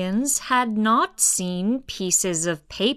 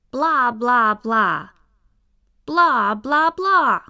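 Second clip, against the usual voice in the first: loud, rising nonsense chatter; alien babble; 2 semitones higher, 5 dB louder than usual. Acted. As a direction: taunt them in alien gibberish.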